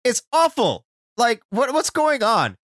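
high-pitched exasperated outburst; worked-up, incredulous complaining; exasperated rant. Real, not acted. Exasperated rant about bad writing.

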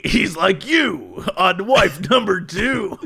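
slow shouted tagline, laughing; radio ad pitchman. Retold or imitated. Listener quoting the insurance ad to his friend, shouted, amused announcer. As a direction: belt the ad line, cracking up. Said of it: "I laugh when it's like".

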